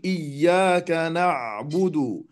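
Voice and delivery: "إياك نعبد" with the ع held a moment; تلاوة بطيئة مع الوقوف على العين; slow recitation. Shown as a demonstration. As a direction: pause briefly on the ع of "نعبد". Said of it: "فنقول".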